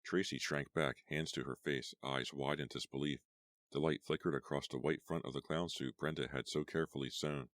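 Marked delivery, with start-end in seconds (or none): none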